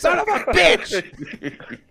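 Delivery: high, slow, very loud imitated curse; mock furious yell. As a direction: bellow it as the losing uncle, laughing. Retold uncle's outburst at losing game.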